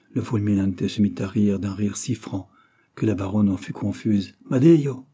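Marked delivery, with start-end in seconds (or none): 4.5-5.1 higher, louder cry of the name; exclaiming. A narrator's reading. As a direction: exclaim it in amused disbelief. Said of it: "s'écria-t-il".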